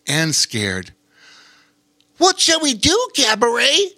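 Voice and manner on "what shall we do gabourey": much higher, louder little otter voice; a small worried otter asking for help; young otter asking. Acted. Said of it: "asked Bobo Chico".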